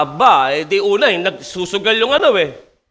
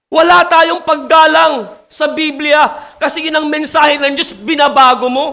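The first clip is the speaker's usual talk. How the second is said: louder, higher, insistent sermon rebuke; raised preaching voice scolding pastors who change the message; preaching at full force; 4 semitones higher, 4 dB louder than usual. Acted. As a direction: drive it home like a pulpit warning.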